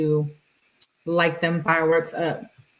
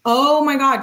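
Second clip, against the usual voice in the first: exasperated; 7 semitones higher, 6 dB louder than usual. Real, not acted. Exasperated at girl's text.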